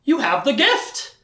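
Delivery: high-pitched, acted astonishment; hammed-up amazement at a revelation; flabbergasted. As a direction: play stunned disbelief, pitch jumping up. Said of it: "like flabbergasted".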